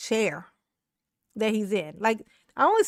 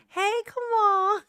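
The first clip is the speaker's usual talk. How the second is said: high, slow, drawn-out greeting; Alicia's funny voice; 9 semitones higher, 3 dB louder, slower than usual. Retold or imitated. Imitating Alicia walking up to Kamal, funny high voice. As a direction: stretch it out in a put-on high voice. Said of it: "she got that funny voice".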